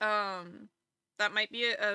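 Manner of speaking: ordinary talk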